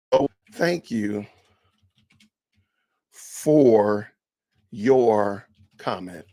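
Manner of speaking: low, slow sarcastic thanks; drawn-out fake gratitude; mock polite reply. Shown as a demonstration. Vlogger demoing a reply backwards, low and slow. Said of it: "Now I'm gonna do it backwards".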